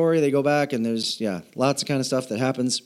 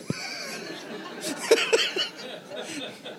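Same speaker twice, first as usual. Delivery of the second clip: high breathy laugh at himself; airy laugh; 7 dB quieter than usual. Real, not acted. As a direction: laugh off the joke about his age. Laughs about his wife's choice.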